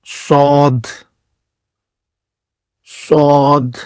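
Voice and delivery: صاد thick and pressed, a heavy s; heavy Arabic sad. Shown as a demonstration. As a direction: fill the mouth and press out صاد. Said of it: "دباؤ کے ساتھ موٹا کر کے پڑھیں گے".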